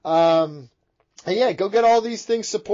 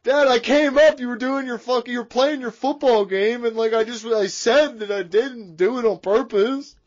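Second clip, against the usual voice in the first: higher-pitched mock child pleading; whiny kid; 4 semitones higher than usual. Kid excusing himself to dad, mock whining. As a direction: whine excuses like a kid in trouble.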